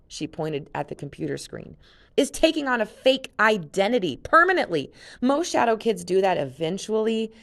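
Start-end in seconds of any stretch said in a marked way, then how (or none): 2.2-7.3 high, loud, fierce retort. Acted. Jen corrects Luke, fierce and loud. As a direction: snap back hard and high. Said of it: "her fierce gaze".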